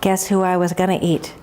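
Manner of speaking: low wolf voice owning up; lightly voiced low, hesitant wolf; confessing wolf. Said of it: "And the wolf says".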